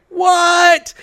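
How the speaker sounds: high, drawn-out shouted disbelief; mock outburst of shock. Stranger first laying eyes on him, shocked shout.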